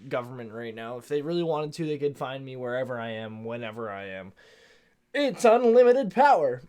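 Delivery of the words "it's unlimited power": high, loud villain cackle-voice; Emperor Palpatine impression. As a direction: shriek it like a mad emperor. Quoting Palpatine's famous line.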